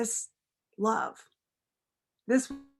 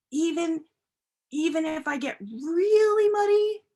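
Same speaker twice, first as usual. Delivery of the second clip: put-on eager child; 4 semitones higher, 4 dB louder than usual.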